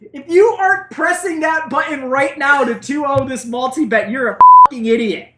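excited rant with bleeped curse; high laughing rant, curse bleeped. Real, not acted. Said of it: "I'm sorry for all the bleeps".

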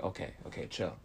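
hushed whisper to himself. Retold or imitated. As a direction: whisper it to calm yourself down. Calming himself, retold inner voice.

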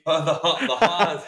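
chuckling through the words; laughing. Real, not acted. Co-host joking about the slip, laughing. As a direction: laugh as you say it.

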